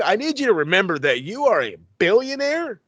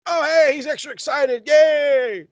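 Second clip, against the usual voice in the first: mock excitement; 10 semitones higher, 4 dB louder than usual. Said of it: "mocking the excited delivery".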